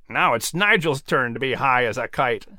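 amused eagle. Acted. Eagle joking about the mouse.

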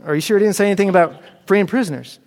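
John pressing hard for the answer he hopes for; insistent pressing. Acted. John pressing disciples in prison.

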